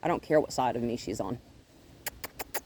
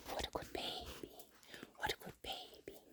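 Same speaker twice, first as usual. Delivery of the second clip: whispering; 20 dB quieter than usual. Real, not acted. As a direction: hush it to her tenderly. Whispering to the dog.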